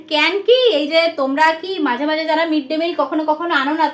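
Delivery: usual speaking voice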